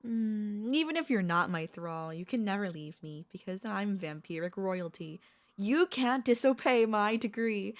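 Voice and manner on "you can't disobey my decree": loud, slow royal decree; imperious command. Acted. Vampire royalty forbidding her pet to disobey, imperious. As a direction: lay down the law, loud and drawn out.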